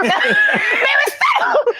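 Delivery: slow, drawn-out high scream through laughter. Retold or imitated. Mother threatening to climb the chair, slow laughing scream. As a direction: stretch it out in a laughing yell. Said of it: "And I'm screaming like that".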